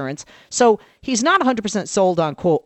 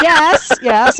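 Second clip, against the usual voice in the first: laughing yes; 7 semitones higher, 6 dB louder than usual. Real, not acted. Exasperated answer to husband.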